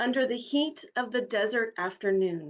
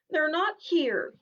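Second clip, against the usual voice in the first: lamely; 7 semitones higher, 3 dB louder, slower than usual. Acted. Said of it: "he finished lamely".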